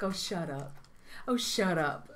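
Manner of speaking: soft, low brush-off; retold dismissal. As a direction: lightly imitate waving off a compliment. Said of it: "Over and over".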